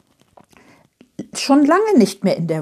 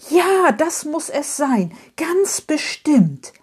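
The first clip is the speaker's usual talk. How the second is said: sudden certainty; 8 semitones higher, slower than usual. A narrator's reading. Character excited by her realisation.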